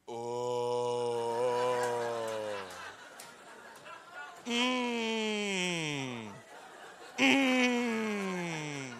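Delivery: long, drawn-out mock-mystical hum; eerie droning moan. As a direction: moan slowly like a spooky prophet. Imitating an eerie stranger behind him.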